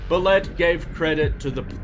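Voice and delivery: usual speaking voice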